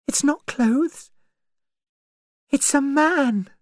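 high, alarmed cry; horrified realisation, pitched high; shocked discovery. A narrator's reading. Vera's shocked discovery of a body.

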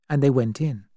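ordinary delivery

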